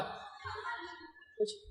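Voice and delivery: breathy high scoffing laugh on the word; mocking laugh. Preacher scoffing at families who claim happy giving, mocking laugh. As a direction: laugh the word away in scorn.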